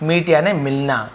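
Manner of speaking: usual speaking voice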